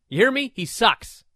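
high, loud insistence; emphatic rant. Real, not acted. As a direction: hammer the point home. Ranting at co-host.

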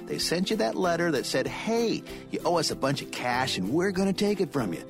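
usual speaking voice

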